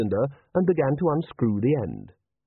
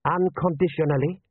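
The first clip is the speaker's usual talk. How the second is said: sharp, higher-pitched question; 6 semitones higher than usual. A narrator's reading. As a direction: query it sharply, pitch lifted. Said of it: "said General Ashal sharply".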